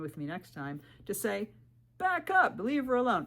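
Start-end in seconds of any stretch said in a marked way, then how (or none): 2.0-3.2 loud, high mock command; imitating protective husband. Husband warning men off, loud protective command. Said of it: "My husband says that".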